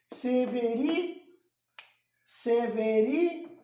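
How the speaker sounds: "Severi" slow, syllable by syllable, rising on RI; drawn-out "Severi", cut off after RI; scanning by syllables. Shown as a demonstration. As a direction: stretch each syllable of "Severi" up to a stressed RI. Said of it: "a última sílaba tônica foi o ri".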